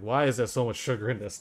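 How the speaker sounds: usual speaking voice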